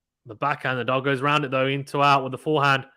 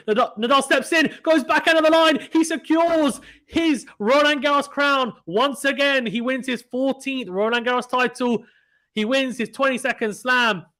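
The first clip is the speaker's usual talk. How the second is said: triumphant excited call; 9 semitones higher than usual. Real, not acted. Commentator calling Nadal's title win, triumphant and excited. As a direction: shout the winning moment to the world. Calling the championship-winning point.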